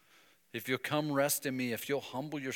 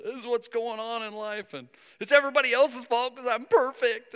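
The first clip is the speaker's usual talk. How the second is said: high, louder whiny excuse; pitched-up self-pity; mock whining; 12 semitones higher, 11 dB louder than usual. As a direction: whine like a child blaming everyone. Whiny self-justifying complaint.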